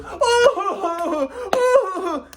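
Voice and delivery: high-pitched derisive hooting laugh; mocking laugh. Grandpa scoffing at grandson's explanation, high mocking laugh. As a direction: imitate a ridiculing laugh, way up high. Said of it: "just like my fucking mom".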